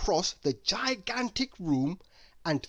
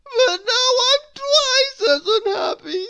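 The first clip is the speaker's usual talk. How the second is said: high, loud wail; 11 dB louder than usual. A narrator's reading. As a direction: wail it in misery. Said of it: "he wailed".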